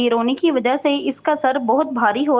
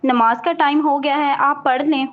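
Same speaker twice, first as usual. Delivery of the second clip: plain reading voice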